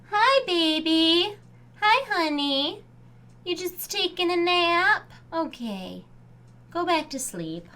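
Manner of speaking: high, slow, soft pet talk; talking to a dog. Real, not acted. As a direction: coo gently at a sleepy pet. Cooing to her sleeping dog.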